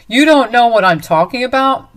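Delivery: loud, mocking disbelief; incredulous anger. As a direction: throw his words back at him. Dante throws back denial.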